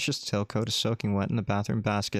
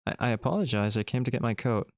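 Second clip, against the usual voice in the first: normal talking voice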